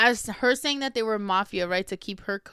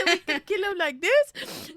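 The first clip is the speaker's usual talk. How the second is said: high-pitched plotting through laughter; giggly excited aunties; 9 semitones higher than usual. Retold or imitated. Aunties proposing ways to kill him, laughing excitedly. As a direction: act out eager aunties, close to cracking up.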